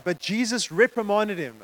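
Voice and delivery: usual speaking voice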